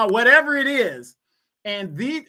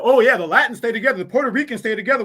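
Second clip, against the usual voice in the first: fast, dismissive mock, louder on the first line; rattled-off mocking quote; 4 dB louder, much faster than usual. Host parodying what Latinos say, fast and scornful. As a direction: rush through their claim as if it's nonsense.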